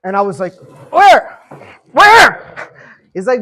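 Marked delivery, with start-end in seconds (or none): 0.9-2.7 high, loud, alarmed yelp; pitched-up, full-volume startled cry; startled shout. Retold or imitated. Startled reaction to the yell.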